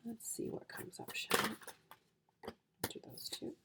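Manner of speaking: whispered aside while drawing cards; hushed card-drawing whisper. Real, not acted. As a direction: breathe it under your breath.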